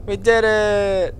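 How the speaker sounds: high, slow victory cheer; triumphant cheer. Real, not acted. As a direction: savor the win.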